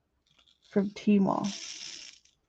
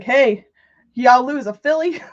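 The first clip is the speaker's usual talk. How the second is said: louder Southern country call; country accent; 3 semitones higher, 8 dB louder than usual. Retold or imitated. Kerwin at the neighbor's door about a horse, louder Southern accent. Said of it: "they're like".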